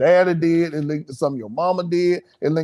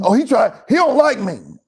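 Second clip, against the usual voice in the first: higher-pitched, hammed-up griping; put-on emotional complaining, pitched up; mock player complaint; 7 semitones higher, 4 dB louder than usual. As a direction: imitate an aggrieved player protesting.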